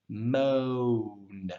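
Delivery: "Moan" with a long oh before the n; rounded vowel. Shown as a demonstration. Teacher shows when to reach the N, lingering rounded vowel. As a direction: draw out "Moan", closing late on the n. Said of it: "Before you draw down to that N".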